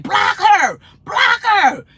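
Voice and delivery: high, loud mock screaming; hammed-up high yell at a moderator; imitated screaming. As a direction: shout it like a frantic, imitated plea. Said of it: "Pretty was screaming through the".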